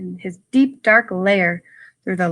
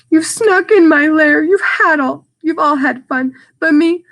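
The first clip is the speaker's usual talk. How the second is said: louder, higher upset bear voice; grumpy bear; 5 semitones higher, 6 dB louder than usual. Acted. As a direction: complain like a hurt, cross bear. Grumpy bear complains to animals.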